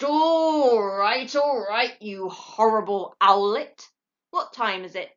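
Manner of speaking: father owl giving in to his pestering son, grumpy. A narrator's reading. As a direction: give in with a groan.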